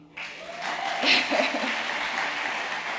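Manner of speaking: airy, breathy happy laugh. Real, not acted. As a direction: laugh with relief and joy. Laugh after "yay.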